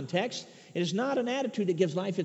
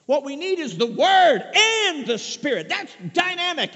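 high, insistent sermon delivery building to a loud peak; impassioned preaching; 8 semitones higher, 5 dB louder than usual. Real, not acted. Preacher urging Word and Spirit together, impassioned. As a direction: build fervently toward a ringing climax.

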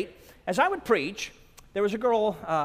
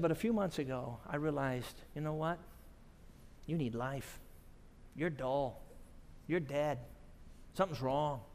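low, soft retold confession; sober realization; 3 semitones lower, 6 dB quieter than usual. Woman realizes she needs life, subdued confession. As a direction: drop low and quiet, pausing after each phrase.

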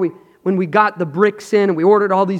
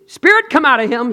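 commanding; 8 semitones higher, 7 dB louder than usual. Retold or imitated. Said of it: "And he said".

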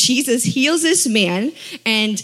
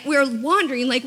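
ordinary talk, nothing marked

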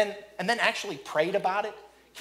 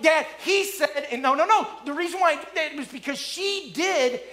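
put-on higher and louder, whiny tattling child; bickering kids; 8 semitones higher, 4 dB louder than usual. Kids tattling to dad mid-fight, whiny bickering. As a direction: whine like a kid blaming a sibling. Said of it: "it always turns into this".

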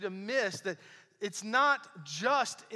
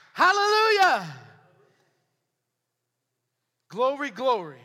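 much higher, loud hallelujah shout; joyful shout; 3 semitones higher, 10 dB louder than usual. Acted. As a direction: shout it out in praise. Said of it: "It makes me wanna shout".

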